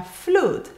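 flute with an oo sound; wrong pronunciation. Shown as a demonstration. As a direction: say flute with a long oo. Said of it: "no se pronuncia". (flute con u larga, incorrecta)

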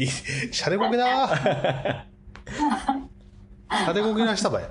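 playful Kumamoto dialect, laughing. Acted. Host jokes back to co-host in Kumamoto dialect. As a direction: toss it off in thick Kumamoto dialect. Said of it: "熊本弁".

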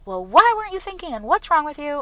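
high-pitched mock angry yell; retold yelling. Stranger still yelling after her apology, high mock yell. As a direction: replay the stranger's shouting, pitched well up. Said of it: "he kept on yelling at me".

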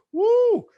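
high loud whoop; cheer. Retold or imitated. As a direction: hand up and let out a big whoop. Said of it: "pick up my hand and just go".

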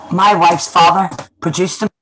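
loud, slow retold declaration; emphatic reveal. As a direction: land the reveal slowly and loudly. Retold surprise reveal by Colin Salmon.

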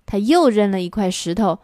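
ordinary delivery, nothing marked

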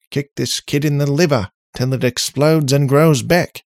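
put-on worked-up Aussie-accented rant; Australian accent. A narrator's reading. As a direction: complain loudly as an Australian-accented robot. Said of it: "again in the Australian accent".

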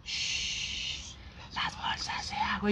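slow hushed whisper; sleepwalker whisper. Retold or imitated. Sleepwalking sister hushing the family, slow whisper. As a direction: hush them, eerily slow.

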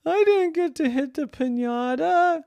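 带着哭腔的高声哀诉; crying little girl. A narrator's reading. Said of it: "she just started crying".

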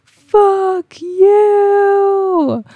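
very high, very loud crying shout; scream to the universe. Retold or imitated. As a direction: scream it skyward with everything. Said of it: "I was just screaming to the universe".

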